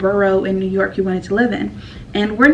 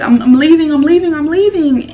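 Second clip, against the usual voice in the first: louder and higher, repeating it insistently; insistent retold cry; 8 semitones higher, 7 dB louder than usual. Telling classmates she is leaving training, loud retold insistence. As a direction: mock-desperate declaration of leaving.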